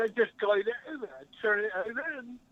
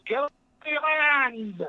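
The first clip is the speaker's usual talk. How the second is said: shouting; 4 semitones higher, 5 dB louder, much slower than usual. Pretend. Caller shouts a joke greeting.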